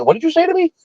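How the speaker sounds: high-pitched, incredulous comeback; indignant challenge. Retold or imitated. Adult confronts the boy who cursed at him, indignant.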